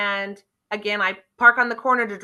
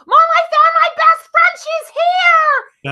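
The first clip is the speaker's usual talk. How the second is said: much higher, much louder overjoyed shout; excited yell; 11 dB louder, slower than usual. Retold or imitated. Woman calling to her mom across the fairgrounds, overjoyed shout. Girl spots her truck friend.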